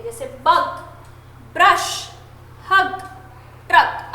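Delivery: बग, ब्रश, हग, ट्रक each cut short; short vowels. Shown as a demonstration. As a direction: separate words, each with a brief vowel. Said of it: "short बोलते हैं".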